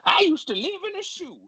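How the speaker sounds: loud, very high prospector holler; old-timey prospector. Acted. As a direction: crow it like a cartoon gold miner. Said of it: "a prospector".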